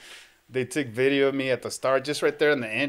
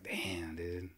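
unvoiced, breathy letdown; whispered damn; 5 semitones lower, 13 dB quieter than usual. Whispering his letdown to the sad kids. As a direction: breathe it out, crushed.

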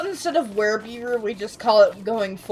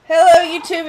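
excited greeting; 7 semitones higher, 8 dB louder than usual. Real, not acted. YouTube intro greeting.

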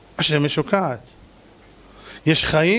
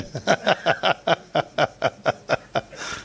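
breathy laugh; 4 dB quieter than usual. Real, not acted. Laughs at the rooster punchline.

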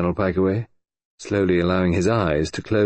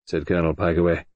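ordinary delivery, nothing marked

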